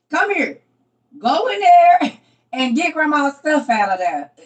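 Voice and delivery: put-on loud, high grandma calling the kids, slowing down. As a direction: call the grandkids in like a bossy grandma.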